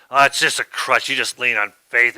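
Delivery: loud, dismissive mock of a critic; lightly voiced scoffing critic; mocking sceptic. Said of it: "I've had people tell me".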